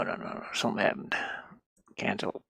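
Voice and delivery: usual speaking voice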